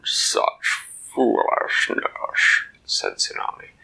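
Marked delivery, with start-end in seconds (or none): 0.0-2.6 much lower, slow, hollow monster voice; deep kraken voice. Acted. Kraken mocks Dak for coming to die, low slow monster voice. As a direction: speak like a vast creature from the deep. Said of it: "in that deep underwater voice".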